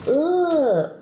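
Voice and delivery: high-pitched mock "ugh" of a critic; mock disgust. Imitating viewers judging her dishwasher, mock disgust. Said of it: "talking about some".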